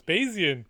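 "Bayesian" said as BAY-zee-un; "Bayesian" in three syllables, BAY-zee-un; three-syllable pronunciation. Shown as a demonstration. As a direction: correct them with "Bayesian", a clear "zee" in it. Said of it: "Is it pronounced Bayesian or Bayesian".

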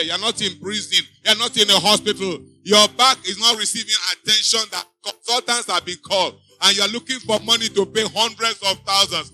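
usual speaking voice

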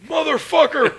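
loud, high mock-furious curse; hammed-up indignant yell; exasperated outburst. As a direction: explode in comic disbelief. Exasperated that partner is fine.

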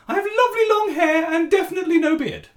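a dad singing out as a comic mum; a hammed-up sing-song mummy greeting; silly put-on lady voice. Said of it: "affected a stupid female accent".

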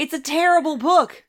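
loud, high indignant protest; playfully outraged shout; heated rebuttal. Real, not acted. Playful argument attacking the book.

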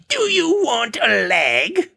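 high, slow witch voice; creepy old hag. Acted. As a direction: croon it like a lurking crone. Narrator warns of Leg Woman.

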